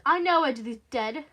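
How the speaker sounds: loud, impatient interruption; irritated man snapping; snapped. Acted. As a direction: cut in forcefully, dragging the words. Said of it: "snapped the man".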